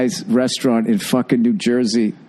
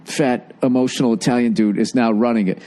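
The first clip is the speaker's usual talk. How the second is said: ordinary talk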